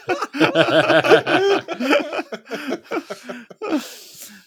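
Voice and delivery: howling high laughter; high loud laugh. Real, not acted. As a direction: burst out laughing.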